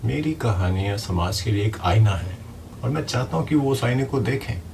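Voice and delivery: ordinary delivery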